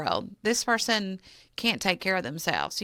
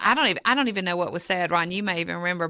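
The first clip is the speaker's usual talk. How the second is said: ordinary talk, nothing marked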